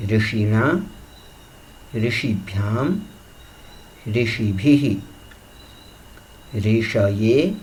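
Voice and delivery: each form spaced out by pauses; recitation drill. Shown as a demonstration. Teacher demonstrating rishi declension to students, careful chant. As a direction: chant the rishi forms clearly, one by one. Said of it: "ऋषि शब्द का रूप उच्चारण करके बताता हूँ".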